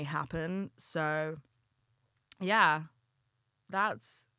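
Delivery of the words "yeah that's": clumsy attempt at a New York accent; slow, put-on New York accent. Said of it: "That was awful. I can't do a New York accent".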